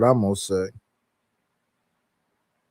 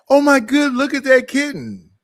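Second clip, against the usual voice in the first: high-pitched gushing, as if suddenly sidetracked; mock distracted excitement; 12 semitones higher than usual. Speaker showing how easily they get distracted, mock excited. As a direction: squeal over something cute, playfully. Acts out getting distracted mid-talk.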